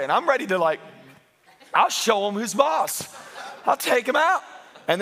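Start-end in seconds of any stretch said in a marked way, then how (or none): 1.7-4.4 mock tough talk.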